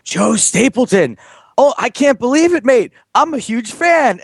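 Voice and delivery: mock excited Scottish fan. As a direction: gush like a starstruck fan. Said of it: "he's like".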